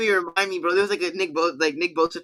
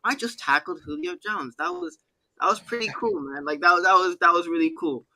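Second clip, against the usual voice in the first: soft, high-pitched, mildly impressed mumble-free delivery; chill Nick Bosa; 4 semitones higher, 3 dB quieter than usual. Retold or imitated. Podcast host mimics player after tackling a star, softly chill, unlike others. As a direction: imitate an athlete quietly understating a big play. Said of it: "Nick Bosa, on the other hand".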